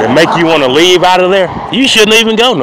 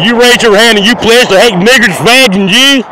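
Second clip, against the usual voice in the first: mocking the klansmen; 7 semitones higher, 4 dB louder than usual. Klan members quizzing the speaker.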